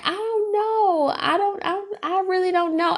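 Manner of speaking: tearful confusion. Retold or imitated. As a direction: lightly voice your younger self close to crying, lost for an answer. Retelling crying on the steps.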